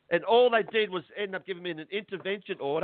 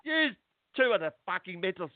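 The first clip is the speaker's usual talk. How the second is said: retold angry outburst; 7 semitones higher than usual. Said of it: "Sorry, I'm getting upset here".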